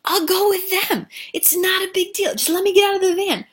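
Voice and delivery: high, loud pleading voice; pleading bodega owner. Acted. Dying owner begging to leave the van, high pleading. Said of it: "he's like".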